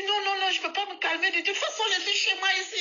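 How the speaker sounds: put-on high, indignant complaining old woman; high old woman ranting; ranting old lady. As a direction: rant like a cross old lady. Old woman refuses to calm.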